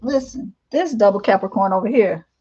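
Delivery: ordinary talk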